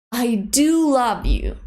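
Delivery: "do" stressed and falling. Shown as a demonstration. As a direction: lean hard on "do" to be believed. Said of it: "Notice that we stress the verb do".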